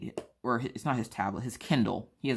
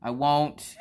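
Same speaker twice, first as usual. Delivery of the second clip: usual speaking voice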